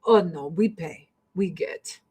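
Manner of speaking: lightly voiced Russian broken English; a hint of a Russian official admitting the bribe; Russian officials admitting the bribe, in a light mock Russian accent. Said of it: "They're like".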